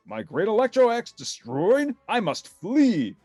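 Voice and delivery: high, loud alarmed cry; panicked villain. Acted. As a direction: cry out in horror and flee. Villain retreats in horror.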